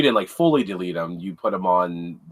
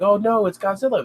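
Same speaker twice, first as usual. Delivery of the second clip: higher-pitched mock alarm; retold cry of alarm; movie panic cry; 10 semitones higher than usual. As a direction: imitate a film extra's alarm. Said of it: "the one part where they're like".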